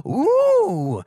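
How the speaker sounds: very high, loud bubbly pony voice; Pinkie Pie. A narrator's reading. Pinkie Pie greeting Stuart with a loud high squeal. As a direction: squeal the welcome like an overexcited pony. Pinkie spots ice cream.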